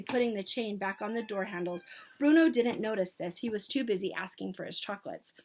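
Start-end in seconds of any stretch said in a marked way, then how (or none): none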